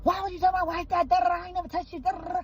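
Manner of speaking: a hammed-up protest from the background; mild mock yell. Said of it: "he's yelling".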